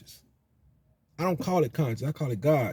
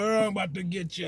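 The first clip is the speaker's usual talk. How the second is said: a hint of a hammed-up movie demon, softer; mock horned demon; 4 dB quieter, faster than usual. Mocking the horned demon people picture, a quiet mock menace. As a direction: toy with a cartoon monster threat, half-joking. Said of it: "not the roar, not the one with the horns".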